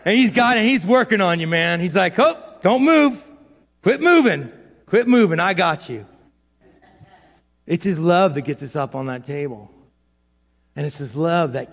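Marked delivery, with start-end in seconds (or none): 2.2-6.0 surgeon's command. Acted. Acts God as surgeon scolding patient.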